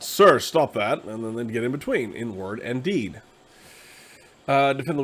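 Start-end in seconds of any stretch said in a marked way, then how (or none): 0.0-1.0 slow, louder command; firm intervention. Acted. Knight stopping guys beating up a kid, slow firm command. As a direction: order it firmly, stretching the words.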